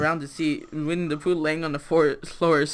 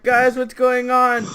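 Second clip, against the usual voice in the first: high, loud, drawn-out question; bewildered alarm; 7 semitones higher, 7 dB louder, slower than usual. Acted. Isaac walking in on the chaos, loudly bewildered. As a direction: call out slowly in mock confusion.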